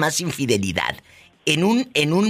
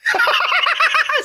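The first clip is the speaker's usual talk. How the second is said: much higher, louder put-on cackle; 4 dB louder than usual. Host cackling at the husband's slip, high character laugh.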